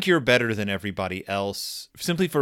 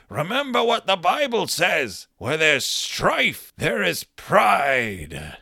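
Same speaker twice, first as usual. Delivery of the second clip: very slow, drawn-out sermon voice; mock solemn grandpa quoting the Bible; preachy Gramps; 3 semitones higher, slower than usual. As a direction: lay down scripture like a stern elder. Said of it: "And he says".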